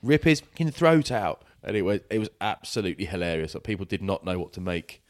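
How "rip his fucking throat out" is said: high, slower mock yell, somewhat restrained; retold shout. Quoting the friend's outburst to co-host, raised mock shout. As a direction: repeat the ringside yell, high and drawn out. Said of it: "he just shouted".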